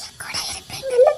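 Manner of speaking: hushed, breaking whisper; tearful whisper. Acted. Wife whispering to her husband, tearful. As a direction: ask in a small scared whisper.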